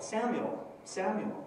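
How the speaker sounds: normal talking voice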